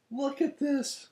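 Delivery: excited. Real, not acted. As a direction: show it off, nearly squealing. Unboxing a gift from ship crew.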